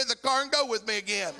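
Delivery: high, loud preacher's climax, voice ringing; preaching at full force. Acted. Preacher urging congregation to hold on to God, shouting. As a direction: lift the room, shouting faith over the storm.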